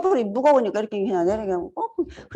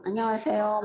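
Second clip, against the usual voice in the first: dull mumbled greeting; 7 dB quieter than usual. Shown as a demonstration. 반가운데도 무뚝뚝하게 중얼거리는 인사. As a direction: mumble it without looking up. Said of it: "막 이래요, 제가".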